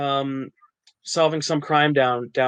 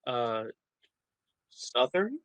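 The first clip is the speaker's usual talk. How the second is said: hesitant, softer, questioning rise; unsure casting director; 9 dB quieter, slower than usual. Retold or imitated. Casting director stumped by which Southern accent, answering unsure. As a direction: trail in softly, then lift it as a question. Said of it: "casting directors just go".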